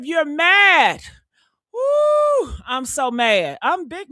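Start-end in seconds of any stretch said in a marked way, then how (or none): 1.7-2.5 high-pitched exasperated "woo"; high venting whoop. Real, not acted. Worked-up woman venting to her viewers, high exasperated whoop. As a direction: let out a high, sharp whoop. Venting about being mad.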